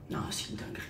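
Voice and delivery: unvoiced, breathy whisper. Real, not acted. Souffle son avis sur le sérum. (chuchoté, tout bas)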